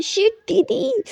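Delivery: slow, high, stretched out in alarm; the dread of suddenly remembering; drawn-out high-pitched gasp of dismay; panicked realization. Acted. Muskan remembers she must wake sister.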